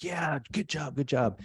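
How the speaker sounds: mock cheerleader praise; cheering on. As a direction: cheer like a sideline coach. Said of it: "on the sidelines cheering you on".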